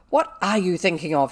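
very loud, angry question; fierce demand. A narrator's reading. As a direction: turn on her and demand. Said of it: "turn on her fiercely".

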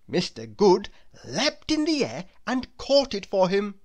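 plain reading voice

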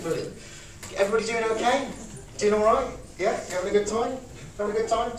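enthusiasm demo. Shown as a demonstration. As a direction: gush over every little thing.

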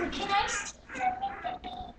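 very high, slow puppy talk; squeaky praising a dog. Real, not acted. Cooing at the puppies.

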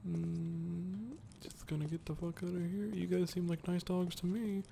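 soft whisper to sneak past. Real, not acted. Gamer creeping past dogs, whispering. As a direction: hush your voice near the dogs.

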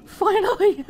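high, elated shout breaking into laughter; relieved laughing cry. Real, not acted. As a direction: let relief burst out laughing. Relieved at reaching the lamp.

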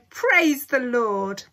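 loud, slow, rejoicing cry; jubilant. A narrator's reading. Grandpa Joe rejoicing, loud and slow. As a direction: drag it out in loud praise.

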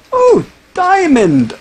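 high, drawn-out excited squeal; hammed-up, high-pitched excitement; mock delight. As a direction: re-enact a thrilled discovery, slowly. Acts out last time's discovery.

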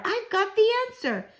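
very high-pitched outburst; excited shout. A narrator's reading. Child announces the solution to grandmother, shouting excitedly. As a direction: exclaim it high, thrilled by the discovery. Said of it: "I shouted".

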